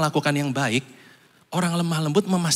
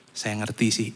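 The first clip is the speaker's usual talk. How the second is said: soft understanding; 7 semitones lower, 5 dB quieter than usual. Retold or imitated. Quoting an understanding listener.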